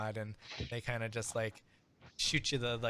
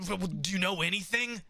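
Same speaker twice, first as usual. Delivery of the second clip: higher, louder anxious questioning; worried Chaz; 7 semitones higher, 5 dB louder than usual. Acted. Worried Chaz pressing the player for answers. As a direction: fret at the player about the missing friends.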